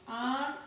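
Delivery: "आँख" with a long, open nasalised vowel; chandrabindu pronunciation. Shown as a demonstration. Teacher repeats the example for students, open "आँख". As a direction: breathe "आँख" out mostly through the mouth. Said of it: "यहाँ पे आप उच्चारण कर रहे हो ना".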